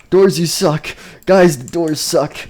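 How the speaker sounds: loud, high exasperated complaint; frustrated yell. Acted. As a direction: yell at the stuck doors, stretching it out. Trapped characters berate stuck doors.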